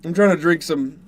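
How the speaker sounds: talking through hiccups; hiccuping. Real, not acted. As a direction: hiccups breaking into the words. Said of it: "I'm hiccuping".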